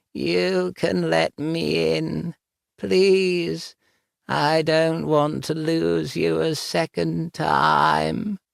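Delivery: high, slow ghostly plea; pleading ghost voice. Acted. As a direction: plead sweetly from the grave.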